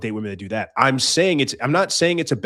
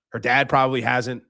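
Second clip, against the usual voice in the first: ordinary talk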